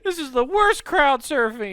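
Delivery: high squeaky victim voice; high put-on voice. Mock victim voice.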